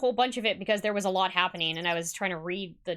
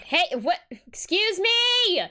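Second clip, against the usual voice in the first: higher and louder, stretched out; indignant shout; 8 semitones higher, 4 dB louder, slower than usual. Acted. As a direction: yell after them, offended. Reads kid being left out.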